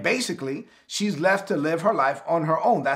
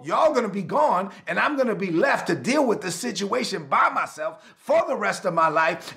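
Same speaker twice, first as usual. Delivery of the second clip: higher, building preacher voice; rising sermon storytelling; 4 semitones higher than usual. Acted. Preacher telling the widow's story with mounting emotion.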